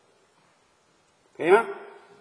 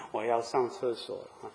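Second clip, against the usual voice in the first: weak sick man's murmur; 2 semitones lower, 6 dB quieter than usual. Retold or imitated. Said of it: "他就很小声地跟你说".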